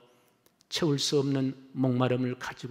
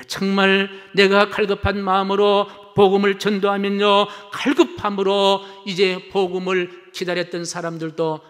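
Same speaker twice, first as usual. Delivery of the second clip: high, loud pulpit proclamation; ringing, urgent sermon delivery; full-force preaching; 7 semitones higher, 8 dB louder than usual. Real, not acted. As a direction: proclaim it to the whole congregation.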